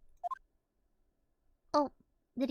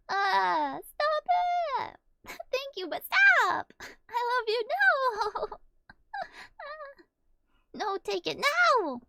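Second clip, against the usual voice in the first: high, loud squealing protest; flustered protest; 9 semitones higher, 5 dB louder, slower than usual. Real, not acted. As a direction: beg her to stop. Protesting generous gift.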